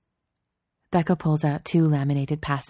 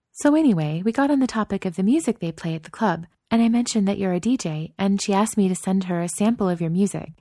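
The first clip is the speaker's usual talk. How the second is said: put-on higher young woman, chatty; girlfriend voice; 5 semitones higher than usual. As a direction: spill it out eagerly. Narrator voices Chloe.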